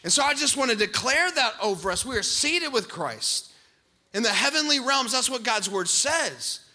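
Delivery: loud, ringing sermon proclamation; full-force preaching. Acted. Pastor declaring to the congregation, full-force preaching. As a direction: preach it out to the whole church.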